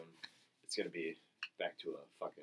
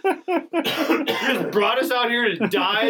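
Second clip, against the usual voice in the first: much higher, loud laughing mock rant at Poe; mock scolding; 13 dB louder, slower than usual. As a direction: scold Poe like a fool, cracking up. Mocking Poe's failed rally.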